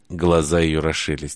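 ordinary delivery, nothing marked

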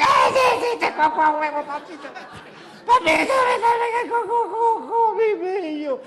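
put-on little boy voice. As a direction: whine like a scared toddler.

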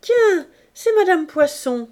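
high, loud cry of surprise; surprised exclamation. Acted. Gervaise greets Virginie with a loud surprised cry. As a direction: hail the visitor. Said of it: "s'écria Gervaise".

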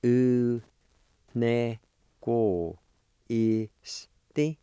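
sounded out syllable by syllable; each syllable said separately and clearly, U ne ko isti; U, ne, ko, isti, spaced apart with pauses; Cherokee word. Shown as a demonstration. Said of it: "it's pronounced like this".

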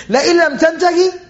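father threatening his son, emphatic; ultimatum. Acted. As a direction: lay down the ultimatum. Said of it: "Issues an ultimatum".

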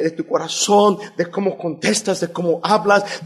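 ordinary delivery

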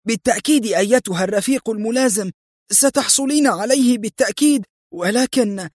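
high, anxious subordinate; acted nervous, high-pitched reply; fearful exclamation. A narrator's reading. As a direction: hurry to placate a superior, pitched high. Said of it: "هتفت الحارسة وهي ترتجف".

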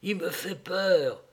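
high, slow, plaintive confession; moaning. A narrator's reading. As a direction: whine it fearfully, drawn out. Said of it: "gémit-elle".

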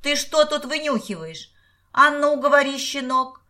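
high, loud, threatening demand; angry interrogation. A narrator's reading. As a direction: demand answers from a caught eavesdropper. Man grabs eavesdropping boy by ear. (грозно, сердитым криком)

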